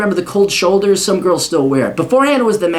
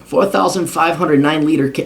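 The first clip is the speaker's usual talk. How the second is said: usual speaking voice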